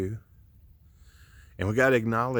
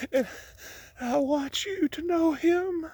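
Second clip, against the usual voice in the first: emotional. Real, not acted.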